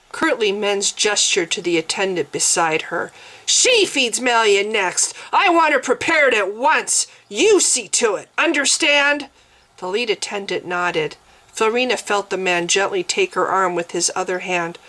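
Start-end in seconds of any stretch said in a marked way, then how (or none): 3.4-9.3 curt command. Acted. Mens orders attendant curtly.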